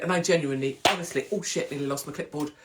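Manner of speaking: ordinary talk, nothing marked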